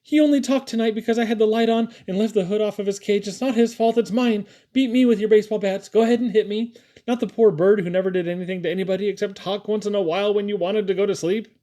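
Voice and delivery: high, insistent pleading; Callie. A narrator's reading. Callie taking the blame to save her parrot, pleading. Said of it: "she pleaded".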